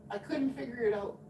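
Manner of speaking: normal talking voice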